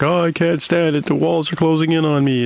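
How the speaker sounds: high-pitched mock-panicked doofus; panicky doofus. Doofus complains of being trapped in the brig, high mock panic. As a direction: whine like a cornered doofus, high. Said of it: "playing a doofus character is like".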